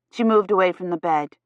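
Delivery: ordinary delivery, nothing marked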